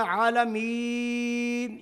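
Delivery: عالمین with long drawn vowel; lengthened عالمین. Shown as a demonstration. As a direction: stretch the madd in عالمین. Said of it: "یہ لمبا کیوں کیا". (مد کے ساتھ عالمین)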